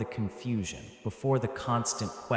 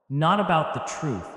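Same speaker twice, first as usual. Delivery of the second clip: louder, higher hit on Not about the truth; rising emphasis; 6 semitones higher, 6 dB louder than usual. A narrator's reading. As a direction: lean hard into the second line. Parallel lines, second one punched.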